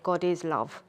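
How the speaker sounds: ordinary delivery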